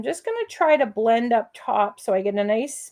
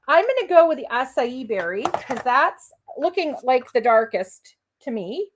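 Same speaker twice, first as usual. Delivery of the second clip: ordinary talk, nothing marked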